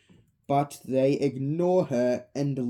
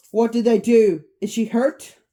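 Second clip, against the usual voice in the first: high, loud urgent questions; alarmed; 6 semitones higher, 8 dB louder than usual. Acted. Mike asks Vanessa about his sister, alarmed. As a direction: demand answers in alarm.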